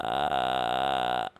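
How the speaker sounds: deep creaky fry on ah; vocal fry. Shown as a demonstration. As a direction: let the voice creak low on ah. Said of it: "que é esse".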